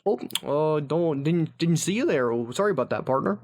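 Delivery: Midwest accent. Shown as a demonstration. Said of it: "What does a Midwest accent sound like?".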